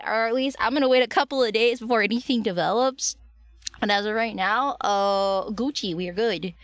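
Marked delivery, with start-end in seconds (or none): none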